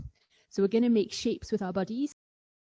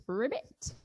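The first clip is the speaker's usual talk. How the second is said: frog ribbit; 7 dB quieter than usual. Pretend. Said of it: "Be a little leapfrog".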